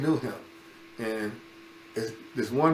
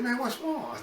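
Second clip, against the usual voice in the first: much higher, softer puzzled question; puzzled friend; 11 semitones higher, 4 dB quieter than usual. Retold or imitated. Friend asking the boy what is wrong, much higher. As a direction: ask it like a baffled buddy.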